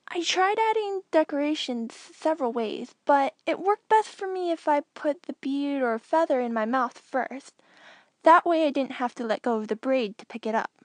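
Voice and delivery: put-on a proud kid who loved the puzzle; young Anakin pleased. Anakin explaining braid technique.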